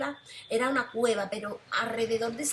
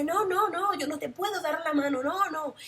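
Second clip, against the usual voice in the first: much higher, louder panicked no; frantic scream; 6 semitones higher, 3 dB louder than usual. Retold or imitated. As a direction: shriek the refusal in terror.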